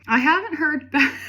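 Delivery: high, loud, breaking into real laughter; laughing. Real, not acted. Reader cracking up at the absurd journal entry, laughing. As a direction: crack up halfway through reading it.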